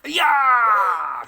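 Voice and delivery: loud effortful yell; playful shout. Real, not acted. Dad swinging his heavy kid, an effortful shout.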